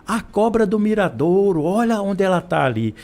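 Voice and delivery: put-on excited child's call, high-pitched; mock shout of a boy spotting a snake. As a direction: call out to dad like a startled kid. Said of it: "o menino grita assim".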